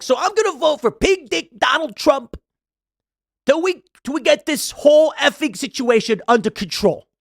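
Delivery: high mocking impression; imitating Michael Rapaport. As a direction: do his voice, high and pushy. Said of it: "I'm trying to imitate him".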